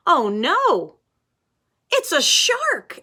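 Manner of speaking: loud, high storybook fright; hammed-up scare for the children; mock alarm. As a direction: cry out at the shark, high and loud. Shark appears in the story.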